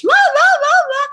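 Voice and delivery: mock the shrill old voice; hammed-up high-pitched la la; stupid high pitch. Shown as a demonstration. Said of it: "that stupid high pitch".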